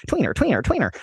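high, sing-song tweener tweener; imitating Silas Howard and Harry Dodge. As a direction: chirp it like they do. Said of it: "That was really accurate".

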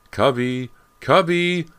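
high, loud, desperate calls; desperate shouting. A narrator's reading. Boy in the backyard, shouting desperately for his dog. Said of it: "James's desperate shouts".

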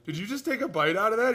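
laughing high-pitched outrage; high, loud disbelief. Retold or imitated. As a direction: squeal it in disbelief. Teammate shares bitten banana.

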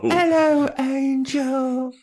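very high, exaggerated accented greeting; Drusilla. Shown as a demonstration. Friend demonstrates her accent, high and wildly exaggerated. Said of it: "That's what she sounds like".

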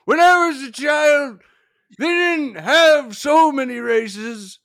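put-on shouting old-timey man. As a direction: holler like a confused old man. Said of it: "answer in an old-timey voice".

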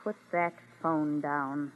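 quiet, slow, threatening order; menacing low command. Acted. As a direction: warn her in a low, slow voice. Threatening woman on the phone.

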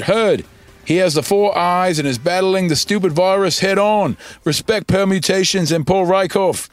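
listener's tweet in an imitated Australian accent. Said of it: "That's my Australian accent".

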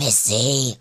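a low, venomous drawn-out Missy. Retold or imitated. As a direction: spit the name out like poison. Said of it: "Venom escaped her lips with that Missy".

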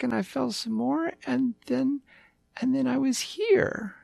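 high, eager little puppy; a lost small pup, chirpy and sweet; yappy panting puppy. Acted.

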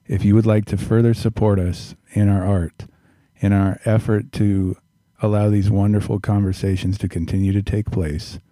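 big loud ad read; announcer voice. Acted. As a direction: pitch the Patreon like a radio host. Patreon plug in announcer voice.

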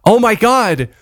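high, alarmed mock neighbor; imitating neighbor alarm. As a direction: call it out like a scandalized neighbor. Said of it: "one of the neighbors goes".

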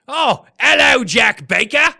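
loud, high hammed-up Cockney greeting; mock Cockney holler; attempted British accent. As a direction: shout it in a put-on British accent, veering Australian. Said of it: "Or is that more Cockney?".

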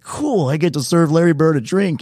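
high, slow, starry-eyed gush; a fan about to meet his hero, slow and high; giddy excitement. Retold or imitated. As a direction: imitate your overeager younger self. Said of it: "I was so, like, ready".

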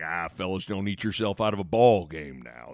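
low, soft coach warning; Coach Altman. Retold or imitated. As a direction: imitate a coach mumbling a warning. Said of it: "Coach is always kinda psycho".

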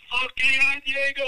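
loud, high hype yell; cheering shout. Retold or imitated. Cousins greeting Diego after the brothel, loud cheer. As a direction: cheer him like a buddy.

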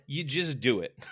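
loud, punchy imitation of a motivator; motivational speaker's answer. Speaker answering a worker's problem, loud motivator voice. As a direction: fire back a simple command. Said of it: "He's like".

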